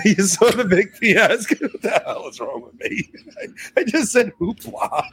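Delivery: talking through helpless laughter; laughing. Real, not acted. Speaker cracks up at own word choice, laughing.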